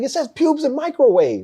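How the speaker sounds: high, outraged mock yell; indignant outburst. Marine who failed inspection, high indignant outburst. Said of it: "He's like".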